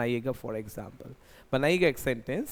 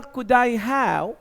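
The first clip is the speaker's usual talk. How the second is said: Could I have, slow and higher, rising on I; model phrase; 8 semitones higher, 7 dB louder, much slower than usual. Shown as a demonstration. Teacher reads the board phrase to students, slow and rising. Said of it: "बीच में मैंने dead dash".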